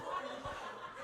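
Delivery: a quiet breathy laugh before speaking; soft laugh. Real, not acted. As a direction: let a soft real laugh slip out. Laughing at his own photo.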